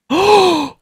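sharp, very high gasp of delight; sudden thrilled intake of breath; excited gasp. Real, not acted. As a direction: gasp out loud in surprise. Sees the tongue in game.

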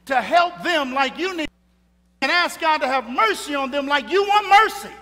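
high, loud preacher's plea; impassioned pulpit cry; fervent preaching. Real, not acted. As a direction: preach it at full force, pleading for mercy. Preaches at full force.

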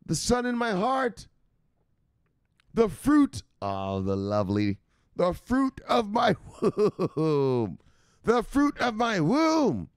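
high-pitched scolding mom voice; shrill mock mom yelling at her son; mother. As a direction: play the nagging mother calling down the stairs. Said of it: "his mother doesn't talk like that".

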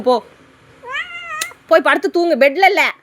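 loud, high command; scolding order. Real, not acted. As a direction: order a pet off to bed loudly. Ordering pet off to sleep.

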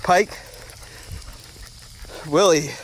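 loud outdoor call to each dog by name. Real, not acted. Owner calling out to his three dogs on a walk, loud call.

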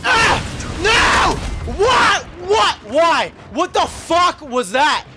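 high, loud aggressive shouting; furious yell. Real, not acted. Gamer confronting an enemy, shouting in rage. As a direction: scream at the enemy. Raging at teammate.

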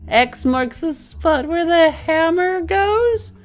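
high, slow dramatic declaration; dramatic hero. Acted. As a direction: proclaim it like a hero. Hero's line about the X.

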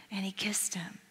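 whispered softly, almost to yourself; hushed whisper. A narrator's reading. Preacher telling his congregation of the father's kiss, in a whisper. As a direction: let it drop to a reverent whisper. Tender climax of the retold parable.

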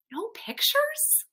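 taken aback, voice lifting right up; surprised question. Acted. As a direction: ask it in high-pitched, quiet disbelief. Reader reacts surprised to title.

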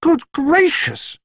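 high, slow, drawn-out gasp of surprise; exclaimed. A narrator's reading. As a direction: stretch it out in shocked alarm. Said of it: "she exclaimed".

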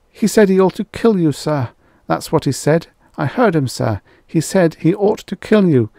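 high, loud, rushed blame; frantic accusation. Acted. Rye accuses Mia to Extroni, high and frantic. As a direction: rush it out to save yourself.

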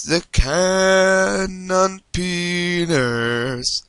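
drawn out very slowly in a put-on voice; mock announcement. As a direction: stretch every word like a silly announcer.

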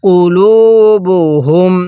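কুলুবুহুম drawn out with long u vowels; measured model reading of কুলুবুহুম; slow tajweed recitation. Shown as a demonstration. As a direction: chant out কুলুবুহুম slowly, holding "luu". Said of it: "কিভাবে পড়ব?".

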